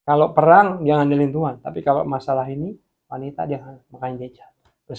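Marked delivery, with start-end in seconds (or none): none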